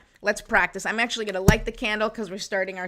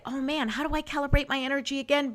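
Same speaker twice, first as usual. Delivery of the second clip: quick, higher-pitched puzzled self-talk; mock fretting; 5 semitones higher, faster than usual. Host voices a forgetful guide user, mock fretting. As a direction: play someone who has forgotten, lightly hammed-up.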